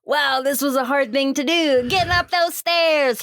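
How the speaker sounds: high, hammed-up punchline delivery; landing a joke. Old screenwriter jokes on stage, high hammed-up punchline. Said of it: "then he lands the joke".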